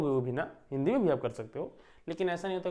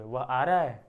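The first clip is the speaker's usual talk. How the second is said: "वह आ रहा है?" asked as a louder question; question tune; 5 dB louder, slower than usual. Shown as a demonstration. Teacher demonstrating question intonation to the class, louder. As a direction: ask it wondering why he hasn't arrived yet. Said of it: "प्रश्न के रूप में पूछोगे".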